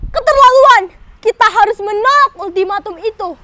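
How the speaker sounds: loud, high outrage; outraged. Acted. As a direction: refuse it with fury. Fighter rejects ultimatum.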